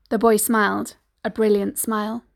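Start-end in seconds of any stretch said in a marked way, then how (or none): none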